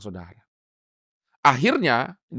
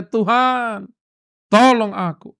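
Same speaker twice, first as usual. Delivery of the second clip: urgent plea; 4 semitones higher, slower than usual. Shown as a demonstration. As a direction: plead "Tuhan, tolong aku" with urgency. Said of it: "yang dia bisa ucapkan hanya". ("Tuhan, tolong aku" diserukan mendesak, "tolong" ditekan)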